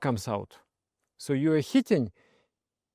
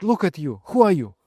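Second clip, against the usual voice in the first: louder, higher reproach; scolding friend; 5 semitones higher, 6 dB louder than usual. Retold or imitated. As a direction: shake him with the question. Friend scolding drunk monk.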